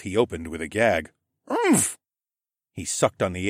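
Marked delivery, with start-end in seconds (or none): 1.5-2.0 high muffled gagging oomph; startled stifled noise; muffled grunt. A narrator's reading. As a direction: grunt with mouth suddenly stuffed. Nut popped into gagging mouth.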